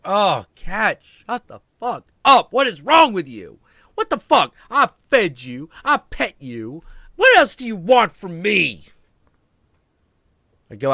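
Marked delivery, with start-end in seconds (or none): 0.0-8.8 high, loud exasperated yell; yelling at the cat. Retold or imitated. Owner yelling at his meowing cat, loud and fed up. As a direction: blow up at a nagging pet. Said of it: "I'm like".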